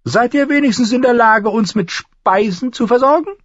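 high, loud, lordly dwarf; demanding dwarf lord. Acted. Dwarf asks the innkeeper for food, high and demanding. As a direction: question her in a high, imperious voice.

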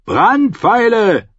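high, slow, drawn-out shout; calling out. A narrator's reading. Commander orders fire arrows, calling out. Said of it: "rief er".

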